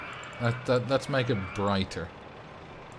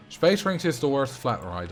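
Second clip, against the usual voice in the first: usual speaking voice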